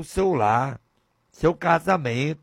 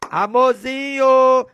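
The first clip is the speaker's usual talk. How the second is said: higher, sweet and drawn out; loving husband greeting; 8 semitones higher, 6 dB louder, slower than usual. Acted. Husband arriving home, sweetly greeting his wife. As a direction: coo it like a happy husband home. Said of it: "Aquela alegria de chegar em casa".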